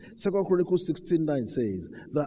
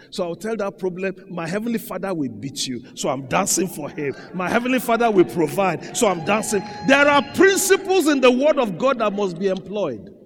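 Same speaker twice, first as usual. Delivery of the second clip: fiery pulpit declaration, climbing at the end; full-force preaching; 4 semitones higher, 10 dB louder than usual. Acted. Preacher telling a problem his heavenly Father will win, full-force preaching. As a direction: build louder and louder to a shout.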